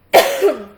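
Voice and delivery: harsh coughing fit after choking. Real, not acted. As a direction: cough hard like something went down wrong. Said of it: "I just choked on a little piece".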